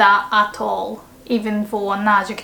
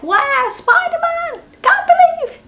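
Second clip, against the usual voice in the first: excited fan. Shown as a demonstration. Starstruck fan meets Spider-Man, very high squeal.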